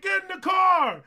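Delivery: high, loud shouted order; mom yelling. Retold or imitated. Mom shouting at her runaway son to get in. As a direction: bark it from the van window.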